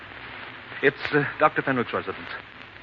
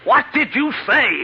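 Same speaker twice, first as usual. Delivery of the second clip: much higher, louder and slow, every word dragged out; horrified shout; 11 semitones higher, 6 dB louder, slower than usual. Acted. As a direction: scream it in horror. Hears doctor call him killer.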